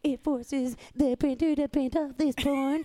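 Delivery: excuse-making in a squeezed cartoon-kid voice; Cartman. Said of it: "Why do you sound like Cartman?".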